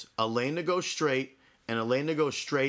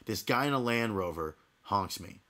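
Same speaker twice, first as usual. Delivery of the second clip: ordinary talk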